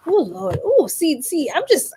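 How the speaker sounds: normal talking voice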